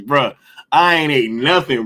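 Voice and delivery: normal talking voice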